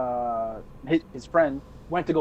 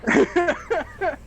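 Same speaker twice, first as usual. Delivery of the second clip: high burst of laughter; 13 semitones higher than usual. Real, not acted. Laughs at own story.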